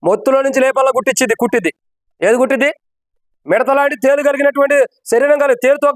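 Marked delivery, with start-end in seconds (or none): none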